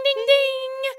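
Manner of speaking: high sing-song ding ding; phone ding. Retold or imitated. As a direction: chime it like a phone. Imitating phone ding sound.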